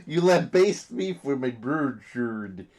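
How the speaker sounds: slow, botched mock-Swedish impression; Swedish Chef-like accent. Mocked co-host defends his bard voice, botched Swedish Chef accent. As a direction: garble the vowels like a bad Swedish Chef. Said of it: "you sound like the".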